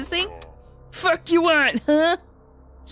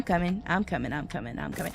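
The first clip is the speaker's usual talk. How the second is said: fading away; 7 semitones lower, 8 dB quieter, faster than usual. Pretend. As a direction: start normal then sink far lower and much softer. Fading out while walking away.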